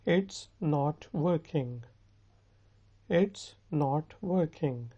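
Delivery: very slow, word by word; slow model sentence. Shown as a demonstration. Teacher giving students a sentence to repeat, slowly. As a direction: space the words for learners.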